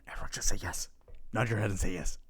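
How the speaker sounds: usual speaking voice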